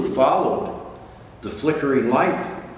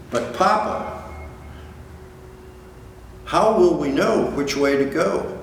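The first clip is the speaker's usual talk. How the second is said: lightly voiced little whale, higher and curious; child voice; 5 semitones higher than usual. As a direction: ask like a small eager child. Child whale asks father.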